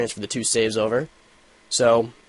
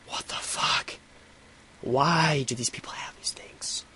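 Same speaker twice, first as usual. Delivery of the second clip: hushed whisper under his breath; 4 semitones higher, 6 dB quieter, slower than usual. Retold or imitated. Grumbling about the earpiece guy ahead in line, hushed whisper.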